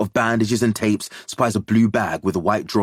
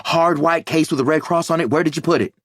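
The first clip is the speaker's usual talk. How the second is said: tight voice; 7 semitones higher, 3 dB louder, faster than usual. A narrator's reading. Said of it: "voice tight".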